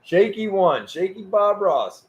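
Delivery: usual speaking voice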